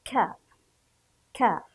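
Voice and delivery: "ك" as a short, separate ka; isolated syllable. Shown as a demonstration. Teacher answers how to read it, "ك" sounded alone as ka. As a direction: give "ك" alone with its fatha, then pause. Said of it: "كيف سنقرأ؟".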